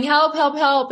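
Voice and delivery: high, loud mock yell for help; retold cry for help. Man in a pit yelling to passersby, high mock yell. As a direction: call out from the bottom of the pit. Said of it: "He kept yelling".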